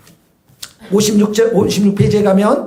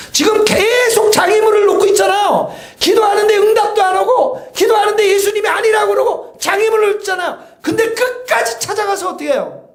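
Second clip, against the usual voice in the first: high, loud preacher's cry; fiery preaching; 9 semitones higher, 3 dB louder than usual. Acted. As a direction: drive the point home at full force. Preaching at full force. (온 힘을 다해 외치는 설교)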